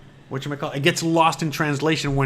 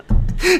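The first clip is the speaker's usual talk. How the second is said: laughing; 14 semitones higher, 5 dB louder than usual. Real, not acted. Laughing at his own skit.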